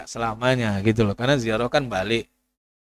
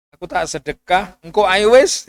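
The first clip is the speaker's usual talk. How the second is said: eager almsgiver; 5 semitones higher, 6 dB louder than usual. Retold or imitated. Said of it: "Hanya jangan nunda amal".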